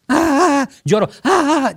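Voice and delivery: a hammed-up sobbing cry, pitched well up; showing how to let the crying out, with loud high wails; demonstrating a wail. Shown as a demonstration. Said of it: "como lo hiciste ahorita".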